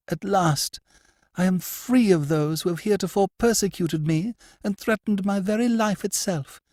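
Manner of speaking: lightly high, fervent; hint of a relieved high voice; relieved lady. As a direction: let relief lift the voice. The lady speaking.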